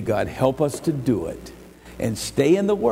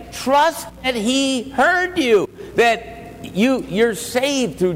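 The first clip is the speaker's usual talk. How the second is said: fervent pulpit preaching voice; preaching at full force; 9 semitones higher than usual. Acted. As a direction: preach it out to the whole room.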